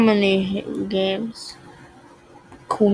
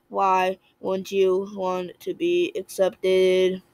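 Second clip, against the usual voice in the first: slow pleading; 4 dB quieter, much slower than usual. Pretend. Pleading with the game.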